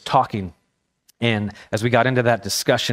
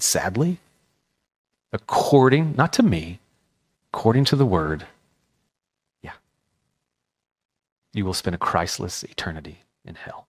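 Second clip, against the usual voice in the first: caring and gentle; 5 semitones lower than usual. Retold or imitated. Student gently answering the girl's question about hell, tender. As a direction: break hard news with care. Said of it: "with care and concern".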